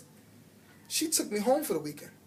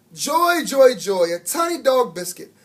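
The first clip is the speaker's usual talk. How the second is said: delighted; 5 semitones higher, 8 dB louder than usual. A narrator's reading. Delighted custodian voicing.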